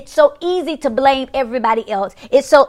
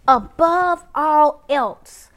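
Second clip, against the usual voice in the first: "Above all else" raised and drawn out very slowly; emphatic repetition; 4 semitones higher, much slower than usual. Real, not acted. Preacher repeats the verse again, drawn-out emphasis on "Above all else". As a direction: ring out each word of "Above all else", long and high.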